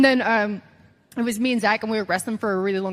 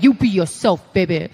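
loud, punchy mentor impression; Dusty Rhodes; 2 semitones lower, 6 dB louder than usual. Trainer telling his student to be herself, loud impression. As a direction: push it out like a big-talking trainer. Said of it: "this is a Dusty impression".

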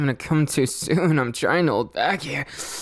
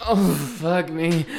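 usual speaking voice

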